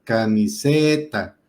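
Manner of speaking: Camiseta drawn out slowly, weight on SE; stress on SE. Shown as a demonstration. Teacher showing students where the voice's force falls, stress on SE. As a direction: lean long on the SE in Camiseta. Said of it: "recae la fuerza de la voz".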